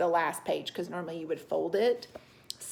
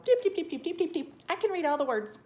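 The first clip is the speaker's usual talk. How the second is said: put-on high, quick babble of a kid racing through words; imitating hasty young readers; 8 semitones higher, 3 dB louder, faster than usual. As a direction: mimic a proud child rattling off words, pitched up high. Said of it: "some of you could just go".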